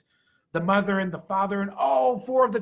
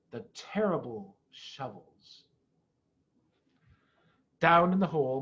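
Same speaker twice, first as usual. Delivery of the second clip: ordinary delivery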